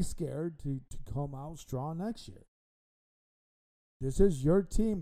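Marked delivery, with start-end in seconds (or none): 4.0-5.0 loud, rising rallying cry; emphatic call. Real, not acted. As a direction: shout it out to rally the team.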